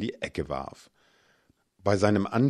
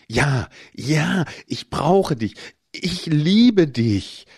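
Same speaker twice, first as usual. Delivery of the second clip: loud, higher moaned words, stretched out slowly at the end; moaning; 7 semitones higher, 7 dB louder, slower than usual. A narrator's reading. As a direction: moan it out in passion. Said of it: "stöhnte ich".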